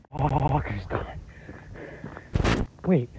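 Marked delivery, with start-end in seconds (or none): none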